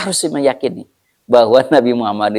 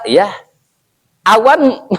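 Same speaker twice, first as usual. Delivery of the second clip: usual speaking voice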